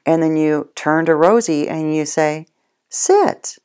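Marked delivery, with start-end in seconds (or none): 2.9-3.6 a high, sing-song "Sit."; high sit command. Shown as a demonstration. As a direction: chirp "Sit." up high to the dog. Command to dog Rosie.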